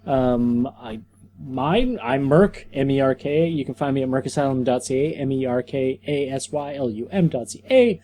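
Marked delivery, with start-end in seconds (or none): none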